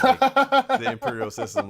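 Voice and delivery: high, loud and slow on "the imperial system"; mocking squeal at imperial units; mock outrage.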